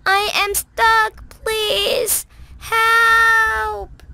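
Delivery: high, loud pleading shout; shouting loudly. Acted. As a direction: shout as loud as you can for rescue. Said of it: "as loudly as she could".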